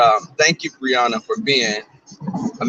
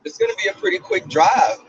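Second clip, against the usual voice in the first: high-pitched. Real, not acted. Sudden high cheerful remark.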